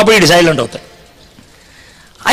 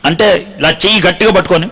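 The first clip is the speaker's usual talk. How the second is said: ordinary talk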